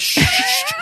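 high shushes breaking into laughter; laughing shush. Retold or imitated. Father re-enacting shushing his son, laughing high. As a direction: shush him while cracking up.

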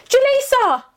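high frantic call of a friend's name. Retold or imitated. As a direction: call out for a friend lost in the maze. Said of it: "all we hear is Sinead go".

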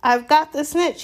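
high, loud triumphant shout. A narrator's reading. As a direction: yell it out in triumph. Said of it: "he shouted".

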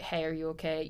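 quiet, gentle, caring; soft check-in. Retold or imitated. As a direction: ask it softly. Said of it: "just to be like".

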